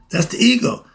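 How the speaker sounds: normal talking voice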